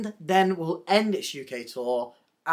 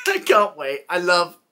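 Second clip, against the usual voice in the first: laughing; 6 dB louder than usual. Real, not acted. Giggling over favourite drag queens.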